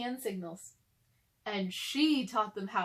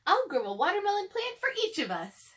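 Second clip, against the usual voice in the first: much higher, louder proud promise; Lily's voice; 9 semitones higher, 4 dB louder than usual. Acted. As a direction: announce it boldly.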